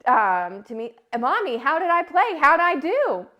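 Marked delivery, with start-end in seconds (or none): 1.2-3.3 put-on child voice. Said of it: "she said".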